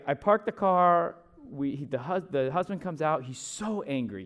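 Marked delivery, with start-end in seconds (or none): none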